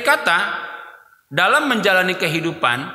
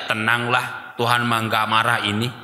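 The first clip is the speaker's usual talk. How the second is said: lower, offhand dismissive tone; mock careless sinner; 7 semitones lower than usual. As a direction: shrug off the sin lightly. (nada santai meremehkan, sedikit dibuat-buat)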